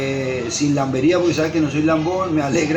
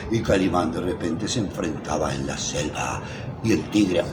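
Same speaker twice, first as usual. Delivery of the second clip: much lower, softer dramatic narrator voice; imitating radio narrator; 11 semitones lower, 7 dB quieter than usual. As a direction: tell it like an old radio serial announcer building suspense. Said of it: "el narrador decía". (imitando al narrador de radionovela, grave y dramático)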